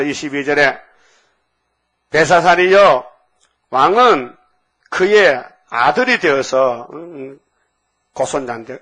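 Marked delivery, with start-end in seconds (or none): 2.1-6.9 loud, slow, declamatory Bible reading; scripture proclamation. Acted. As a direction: proclaim the verse in measured, forceful phrases. Reads the scripture verse aloud.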